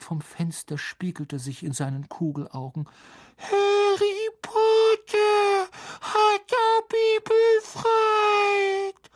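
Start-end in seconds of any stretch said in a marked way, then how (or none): 3.4-9.1 very high, slow squeal; stretched shrill creature squeak; shrill house-elf. A narrator's reading. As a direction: proclaim it in a piercing elf voice. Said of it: "sagte der Elf schrill".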